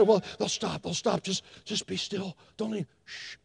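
very soft, anxious shushing; acted keep-still-and-quiet hush; hushed parent. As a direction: fade down to a near-whisper and shush. Parents hushing, hoping baby stops.

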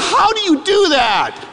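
high, slow, hammed-up plea; mock plaintive cry. Young man marvelling at his roommate's luck, mock plaintive cry. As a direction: wail it out in mock despair. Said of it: "I thought".

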